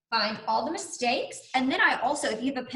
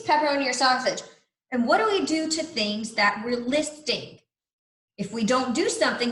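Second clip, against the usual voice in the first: ordinary talk, nothing marked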